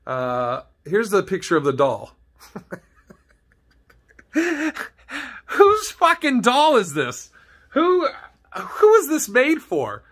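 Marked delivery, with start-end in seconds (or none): 4.3-4.9 a sudden high burst of laughter at the picture; startled squeal of a laugh; shocked high laugh. Real, not acted. 5.4-10.0 high, slow, appalled; aghast at the ugly doll, pitched way up; horrified disbelief. Real, not acted.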